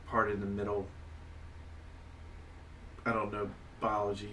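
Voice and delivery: ordinary delivery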